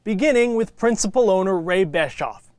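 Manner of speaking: measured, polished TV sports feature narration; broadcast presenter read. Real, not acted. Host introducing Fresno FC to viewers, scripted presenter read.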